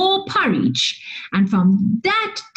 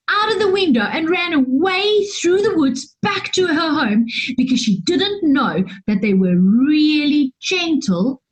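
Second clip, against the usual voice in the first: ordinary delivery, nothing marked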